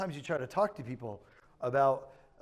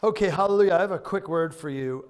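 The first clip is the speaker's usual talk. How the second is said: loud, high call to the crowd; big preacher's opening shout; 6 semitones higher, 11 dB louder than usual. Real, not acted. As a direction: raise your voice over the applause. Preacher calls over applause to congregation.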